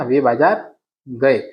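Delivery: slow, deliberate, gए stretched out in वे बाजार गए; teacher confirming the correct sentence slowly; slow correct form. Shown as a demonstration. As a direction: lay down the right answer word by word. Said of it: "नहीं, वे बाजार गए".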